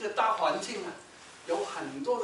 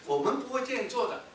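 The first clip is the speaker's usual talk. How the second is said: ordinary talk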